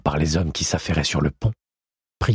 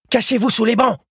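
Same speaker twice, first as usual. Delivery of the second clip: much higher, loud order to hide; urgent call; 4 dB louder than usual. Acted. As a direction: snap the warning at his sister. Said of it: "lança-t-il à sa sœur". (ordre pressant, aigu et fort)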